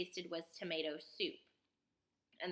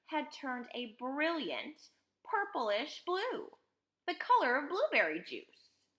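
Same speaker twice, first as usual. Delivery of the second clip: put-on higher, animated storytelling to children; lively read-aloud, pitched up; dramatic story narration; 7 semitones higher, 6 dB louder than usual. A narrator's reading. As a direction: narrate with big swooping emphasis.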